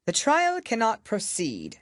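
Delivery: slow, grave royal voice; grave king. A narrator's reading. King addressing the court, slow and grave. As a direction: weigh each word like a solemn king. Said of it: "in a very grave voice".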